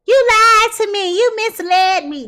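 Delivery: high, loud whiny mimic of the client; mocking the wronged lover's whine; mocking whiny quote. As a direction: parrot her complaint, high and whining, slowing down on the second line.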